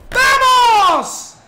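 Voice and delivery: high, loud victory scream; real gamer celebration yell; ecstatic shout. Real, not acted. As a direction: roar it like a last-minute winner. Goal celebration.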